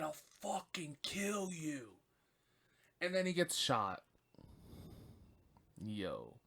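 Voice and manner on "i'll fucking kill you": hissed threat, slowed right down; a whispered death threat, acted out; mock menacing whisper. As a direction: breathe the threat like a taunt. Derek taunting Mickey, retold.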